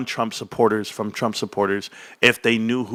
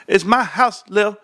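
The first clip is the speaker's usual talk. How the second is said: high put-on comedy voice; Eddie Murphy; 8 semitones higher, 4 dB louder, slower than usual. Dad quoting comedy bits while walking around, high put-on voice. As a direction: toss out a stand-up catchphrase, pitched up. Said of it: "like, Eddie Murphy".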